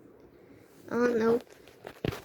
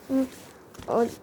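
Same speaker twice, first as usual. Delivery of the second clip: ordinary talk, nothing marked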